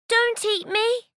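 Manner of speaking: high, begging goat voice; pleading little goat. Acted. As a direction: beg the troll not to eat.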